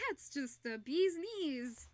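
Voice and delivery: slow, drawn-out Southern drawl; Southern accent. Pretend. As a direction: practice a playful Tennessee accent. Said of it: "They talk like that in Tennessee".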